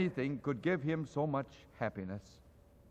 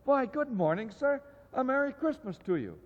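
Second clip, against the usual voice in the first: cheery greeting; 9 semitones higher than usual. Acted. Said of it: "good-humored fellows said".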